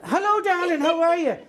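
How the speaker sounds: high, loud puppet hello; effusive greeting. Acted. Puppet greeting his darling co-host, effusive. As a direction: call out eagerly, pitched well up.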